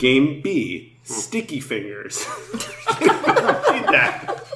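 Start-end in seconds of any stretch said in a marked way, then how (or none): 2.3-4.4 laughing. Real, not acted. Reading silly game name.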